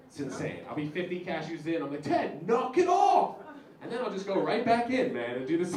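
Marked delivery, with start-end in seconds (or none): 2.0-3.4 high, drawn-out exasperated yell; mock self-scolding shout. Comedian catching himself fifty cashews in, mock scolding shout. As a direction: shout it slowly as a telling-off.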